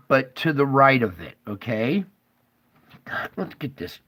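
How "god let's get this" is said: frustrated mutter. Real, not acted. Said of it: "Sorry, I'm getting frustrated".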